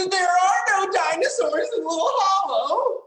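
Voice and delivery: laughing disbelief. Acted.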